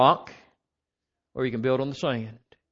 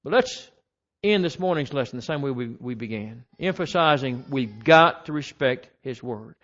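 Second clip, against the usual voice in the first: ordinary talk, nothing marked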